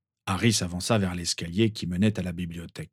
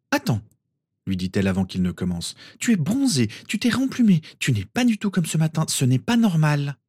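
put-on much higher girl's voice; suspicious girl; Ginny's voice; 7 semitones higher than usual. A narrator's reading. Ginny, wary that Harry has changed, much higher.

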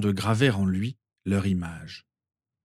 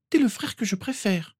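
put-on much higher child's voice; little girl Rose; 13 semitones higher than usual. A narrator's reading. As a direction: play the little sister, very high. (petite Rose, voix d'enfant très aiguë)

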